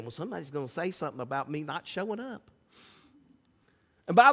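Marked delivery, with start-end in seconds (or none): 0.0-2.5 softer, quick muttered excuse; mumbled excuse. Retold or imitated. Churchgoer mumbling an excuse about skipping the picnic. Congregation member's inner excuse.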